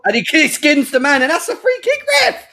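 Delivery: bursting out at the winger being fouled; excited rising yell. Real, not acted. Fans watching a foul live.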